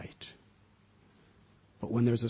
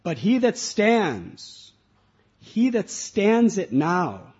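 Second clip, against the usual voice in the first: slow, much louder oratory; solemn reading; 6 dB louder, slower than usual. Real, not acted. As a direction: declaim like a rousing speech. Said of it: "permission to read".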